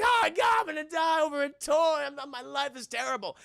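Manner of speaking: high, hammed-up panicked wail; Sinbad. Villain about to die over a toy, mock panic. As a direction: shout in mock despair, then sag.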